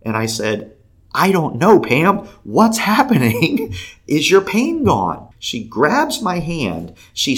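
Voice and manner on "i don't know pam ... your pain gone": high, loud retold excitement; excited questioning. Man asking woman about her pain, excited questioning. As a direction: echo the freak-out back, high and drawn out. Echoes her freak-out back at her.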